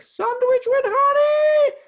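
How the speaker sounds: put-on high, loud, drawn-out child's scream; screaming daughter. Little daughter screaming for her honey sandwich at dawn, shrieked high. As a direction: shriek it like a little girl demanding breakfast. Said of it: "but screaming".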